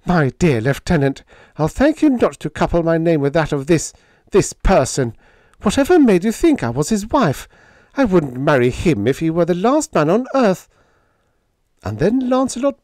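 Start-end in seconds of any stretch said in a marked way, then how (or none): 0.1-10.7 high, loud, affronted female character; indignant. Acted. Helen denying she is Hank's wife, indignant. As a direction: scold in a raised, outraged voice.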